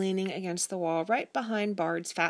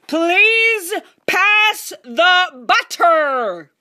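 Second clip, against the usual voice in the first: slow, booming and much higher, each word hammered; very loud voice; 12 semitones higher, 14 dB louder, much slower than usual. Acted. Father at dinner making himself heard, booming slowly. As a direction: bellow it out slowly for all to hear. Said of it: "in a very loud voice".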